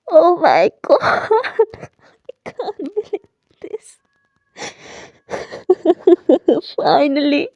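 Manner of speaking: slow, laughing through tears; tearful, laughing and slow; happy tears. Acted. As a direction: choke out the joy between laughs. Character weeps happy tears.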